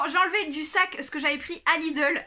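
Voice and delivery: ordinary talk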